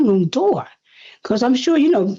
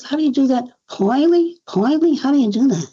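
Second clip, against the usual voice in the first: imitated New York questioning, raised pitch; 4 semitones higher than usual. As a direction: puzzle over the word in a higher voice. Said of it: "She said, she said".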